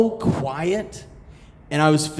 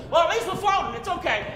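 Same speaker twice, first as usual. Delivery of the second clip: breezy rescuer voice; 4 dB quieter, faster than usual. Retold or imitated. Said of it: "he's like".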